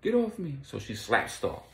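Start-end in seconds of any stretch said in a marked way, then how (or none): 0.0-0.6 high frantic cry; panicked struggle. Retold or imitated. Vicky fighting them off, high frantic. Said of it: "So Vicky was like".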